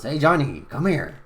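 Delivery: loud shout across the shop; calling out. Acted. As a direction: call Johnny over with a loud shout. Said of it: "he yells".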